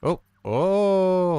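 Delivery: sharp high startled yelp; surprised. Real, not acted. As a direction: caught off guard, loud and high. Sudden surprise while playing.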